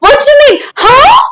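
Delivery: high, loud mock outrage; indignant protest. Streamer answering chat's remark about their speech, mock indignant. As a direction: squawk it in playful disbelief.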